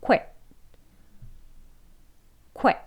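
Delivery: quit said quickly, glide barely there; fast quit. Shown as a demonstration. Teacher showing the quick quit. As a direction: clip quit short and natural. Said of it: "빨리 발음하게 되면".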